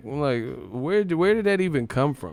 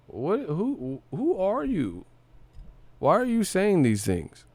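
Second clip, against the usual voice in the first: higher, incredulous questions; baffled disbelief; 7 semitones higher than usual. Real, not acted. Baffled by the interviewee's outdated slang, higher and incredulous. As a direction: ask in stunned confusion.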